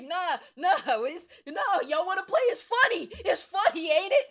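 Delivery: high, loud, laughing taunt. Retold or imitated. To the laughing class, loud mocking taunt. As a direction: throw it back at them, cackling.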